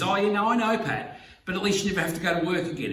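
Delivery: teasing mate. Retold or imitated. Friend Eric ribbing him about never working again, high and fast. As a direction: toss off a joking jab like a cheeky friend. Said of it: "and then he says".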